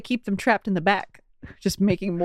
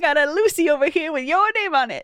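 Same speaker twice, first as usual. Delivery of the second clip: newsboy call; 6 semitones higher than usual. Retold or imitated. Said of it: "Not like a newspaper".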